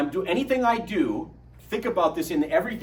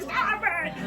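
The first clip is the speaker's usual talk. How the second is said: high, shrill-voiced imitation of a scared wife; wife's frightened plea. Dad imitating his scared wife at the Grand Canyon, high frightened squeal. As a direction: squeal it like a panicked mom. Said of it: "And she's like".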